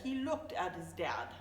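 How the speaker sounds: ordinary delivery, nothing marked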